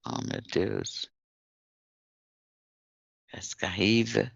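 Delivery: usual speaking voice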